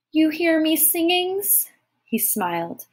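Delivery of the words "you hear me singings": put-on high, slow little-girl voice; curious small child. A narrator's reading. Tyke asks Thomas about her singing, high child's voice. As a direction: ask in a tiny wondering voice.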